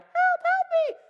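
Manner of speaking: very high falsetto cry for help; damsel in distress. Pretend. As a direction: squeak out a mock plea for rescue. Imagined victim calling for rescue.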